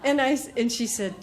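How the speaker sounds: normal talking voice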